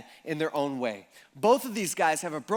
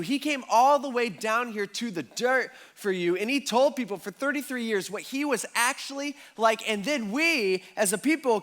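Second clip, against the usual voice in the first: rising, louder sermon push; preaching at full force; 4 semitones higher, 3 dB louder than usual. Acted. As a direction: drive the gospel appeal home to the congregation.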